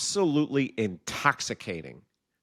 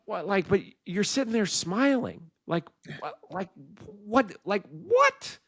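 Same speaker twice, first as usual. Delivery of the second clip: baffled exasperation; 8 semitones higher than usual. Retold or imitated. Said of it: "finally he's like".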